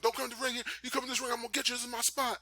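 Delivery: high, frantic mock warning; mock scared bluster. Imitating a wrestler warning zombies from the ring, mock scared bluster. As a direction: defend your spot in a put-on panicky voice.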